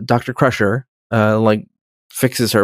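ordinary delivery

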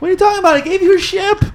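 very high, incredulous retort; indignant protest. Acted. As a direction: protest in an outraged high pitch.

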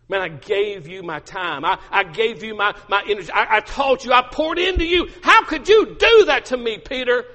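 hurt outburst building to high and loud; acted anger, climbing to an outraged peak; angry reproach. Jesus imagined scolding Peter for betrayal, angry. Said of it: "he was angry".

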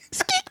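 tiny high squeak noise; silly squeak effect. Pretend. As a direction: make a cartoon squeak. Sound effect repeated.

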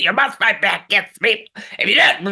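high, hammy ranting impression; mock Alex Jones. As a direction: ham up a furious conspiracy rant. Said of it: "you got him trying to take the rules seriously".

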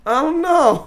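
high-pitched imitation of doubt; mock uncertain quote. Quoting the actress on returning this season, mock uncertain. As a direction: lift the voice high, hammed-up hesitation. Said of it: "the first season that she said".

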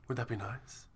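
low, velvety-soft radio murmur. Shown as a demonstration. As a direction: keep it hushed and deep, like a radio host. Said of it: "radio voices".

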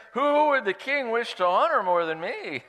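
high, loud self-important boast with a laugh; smug Haman. Acted. Pastor voicing vain Haman, high and laughing. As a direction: puff up like a vain official. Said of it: "true to his character".

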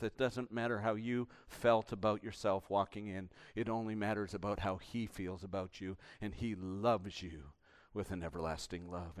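soft, low, earnest pleading; tender pastoral appeal. Real, not acted. Preacher assuring ashamed listeners they are loved, soft appeal. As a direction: bring it right down and speak gently to each listener.

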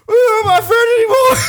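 very high, loud wailing through tears; mock crying. Telling his best friend it's over, mock sobbing. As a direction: bawl it like a hurt kid, hammed up. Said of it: "and he's crying. That's like how I felt".

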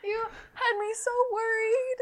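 high, slow mock sob; high mock crying. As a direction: whimper with fake relief. Relieved at finding mouse.

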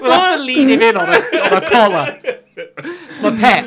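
high, fast and laughing. Real, not acted. Laughing pitching the pet idea.

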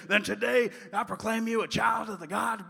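loud, high gravelly-preacher imitation; mock pulpit proclamation; gruff old preacher. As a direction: proclaim it grandly like an old revivalist. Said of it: "his gruff and gravelly voice".

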